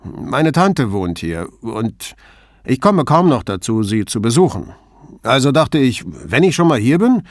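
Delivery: lightly voiced embarrassed boatman; slightly embarrassed. A narrator's reading. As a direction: explain yourself sheepishly and leave it hanging. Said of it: "wirkte ein wenig verlegen".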